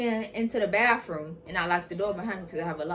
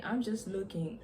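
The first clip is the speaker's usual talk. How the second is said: usual speaking voice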